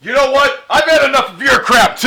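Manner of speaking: loud furious yelling; shouting angry. Acted. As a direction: blow up at him. Shouting fight with crew.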